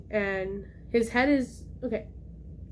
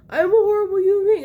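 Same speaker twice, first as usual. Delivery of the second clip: high, loud, fast mock panic; retold horrified self-blame; 10 semitones higher, 8 dB louder, faster than usual. Kid who left dad to die, mock horrified. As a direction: blurt it out in exaggerated dismay.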